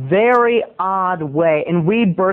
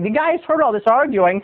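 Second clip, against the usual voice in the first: ordinary delivery, nothing marked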